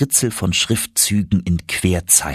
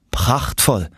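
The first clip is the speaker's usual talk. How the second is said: feigned joy; 4 semitones higher than usual. Acted. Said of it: "mit geheuchelter Freude".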